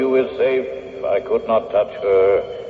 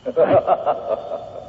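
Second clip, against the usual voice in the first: breathless mocking laugh, pitched up; mocking ghostly laugh; 7 semitones higher than usual. Ghost scoffs at Brian's threat to run him through, breathy mocking laugh.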